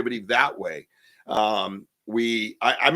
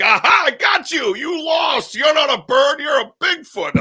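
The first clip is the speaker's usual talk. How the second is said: higher and louder, yelled across a river; gleeful shout; 14 semitones higher, 7 dB louder, slower than usual. Retold or imitated. Yelling across the river at a mimicking Bigfoot, triumphant shout. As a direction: taunt it at the top of your voice. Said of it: "and I yell, I yell across the river".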